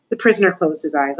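usual speaking voice

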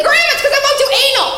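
high, rapid exasperated retort; mock outburst, cutting in fast and high; cutting grandma off. Granddaughter interrupting grandma's questions, exasperated. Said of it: "cut her off and be like".

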